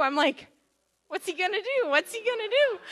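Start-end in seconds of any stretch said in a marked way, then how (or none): 1.1-2.8 high, loud worried question breaking into laughter; laughing mock panic. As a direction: retell your alarm while cracking up. Retelling her panic about the dog.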